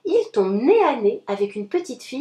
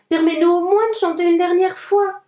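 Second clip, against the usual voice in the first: higher, louder pleading; imploring; 6 semitones higher, 5 dB louder than usual. A narrator's reading. Three little pigs implore the wolf, higher louder plea. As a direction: beg for one last song. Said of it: "implorent les trois petits cochons".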